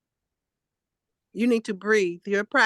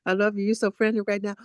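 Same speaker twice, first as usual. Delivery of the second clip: usual speaking voice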